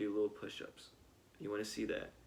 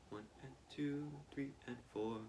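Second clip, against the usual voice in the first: counted quietly, as if in your head; quiet inner count; soft counting; 4 semitones higher, 6 dB quieter than usual. Shown as a demonstration. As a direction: murmur the count under your breath. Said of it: "keep that pulse going in your head".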